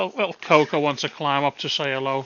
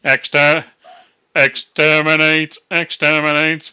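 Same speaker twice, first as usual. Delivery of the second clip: Dalek impression; 8 dB louder, slower than usual. Jokey robot catchphrase.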